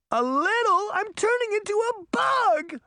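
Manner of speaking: high, loud panicked cry. A narrator's reading. Daughter panicking to her mother about turning into a bug, loud high panic.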